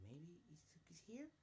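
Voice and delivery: high, slow, hopeful question; put-on high voice, tentatively happy; hopeful, kinda happy. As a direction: wonder it aloud in a lifted, softly happy voice. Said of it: "As she's kinda happy".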